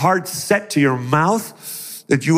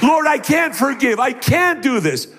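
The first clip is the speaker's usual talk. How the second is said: high, raised-pitch plea of refusal; acted desperate protest; 10 semitones higher than usual. Hurt believer telling the Lord it is impossible, acted protest. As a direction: cry out helplessly to God, acted.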